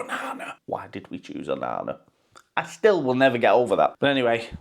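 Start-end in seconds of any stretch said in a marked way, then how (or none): 0.0-2.0 unvoiced, much softer and lower; whispered rueful aside. Real, not acted. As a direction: hush it like a secret regret.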